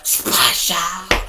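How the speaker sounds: loud, high shouted splash noise; imitated splash. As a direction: burst out with a big splash sound.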